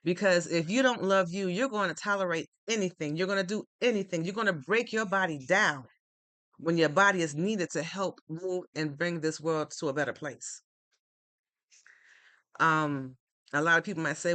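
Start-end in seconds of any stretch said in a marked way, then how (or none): none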